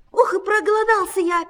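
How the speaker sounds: high, quick mouse squeak. A narrator's reading. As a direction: whine for food in a squeak. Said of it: "пищит Круть".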